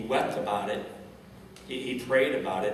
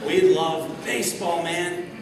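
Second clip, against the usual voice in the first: slow, loud, high proclamation of love; excited cheer; 5 semitones higher, 5 dB louder, slower than usual. Real, not acted. Speaker rallies the crowd about baseball, slow loud cheer. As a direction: belt it out to rally the crowd.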